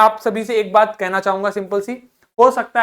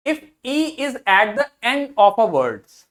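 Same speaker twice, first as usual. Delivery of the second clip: slow dictation; much slower than usual. Shown as a demonstration. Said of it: "teacher reading rule slowly".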